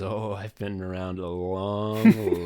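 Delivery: normal talking voice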